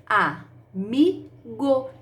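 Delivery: stretched out syllable by syllable, a-mi-go; teacher drawing out "Amigo" for children; slow syllable count. Shown as a demonstration. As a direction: say "Amigo" slowly, opening the mouth on each syllable. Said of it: "quantas vezes eu abro a boca".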